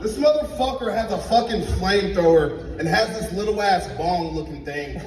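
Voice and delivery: ordinary talk, nothing marked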